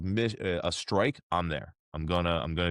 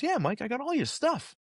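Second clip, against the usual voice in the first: imitating the director. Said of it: "He goes".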